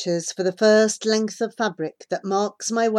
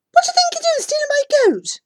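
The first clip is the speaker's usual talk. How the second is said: high, loud, fast accusation; angry shout; 6 dB louder, faster than usual. A narrator's reading. Boy accusing a stranger of taking his goat, angry shout. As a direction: yell it at a thief in one rush.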